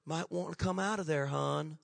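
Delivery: half-hearted murmur to coax someone out; mock whisper. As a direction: lean in and say it very quietly, no urgency. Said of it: "lean over and whisper".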